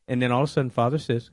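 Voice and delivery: normal talking voice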